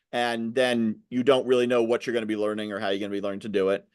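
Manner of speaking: normal talking voice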